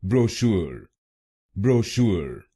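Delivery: ब्रोशूर said as bro-SHOOR with r sounded; ब्रोशूर with American r-colored ending; American pronunciation. Shown as a demonstration. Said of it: "American English में इसको बोलेंगे". (American उच्चारण में ब्रोशूर)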